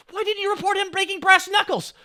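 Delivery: high, loud mock outrage; indignant boss. Boss's reproach acted out.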